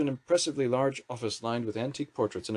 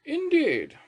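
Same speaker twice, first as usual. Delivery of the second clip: much higher, slow grand lady's drawl; Thatcher's drawl; 6 dB louder than usual. A narrator's reading. Prime Minister greeting the wizard minister with a haughty drawl. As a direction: look down on the new minister.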